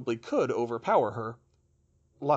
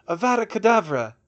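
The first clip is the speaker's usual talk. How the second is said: higher, louder spell cast; shouted curse; 8 semitones higher, 9 dB louder than usual. Acted. Villain casts a killing curse in a duel, loud cry. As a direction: fling the killing curse out loudly.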